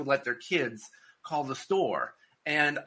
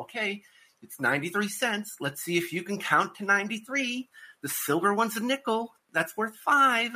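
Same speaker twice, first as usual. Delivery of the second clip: patient parent teaching; 8 semitones higher than usual. Retold or imitated. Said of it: "they go".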